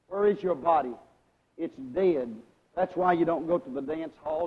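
ordinary delivery

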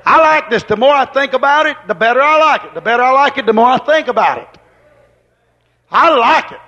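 high, loud and eager; bubbling over with delight; excited. Real, not acted. Preacher telling folks his joy, excited and loud. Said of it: "I'm excited about this".

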